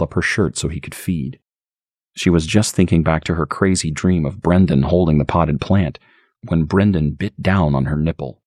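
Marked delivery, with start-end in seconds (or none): none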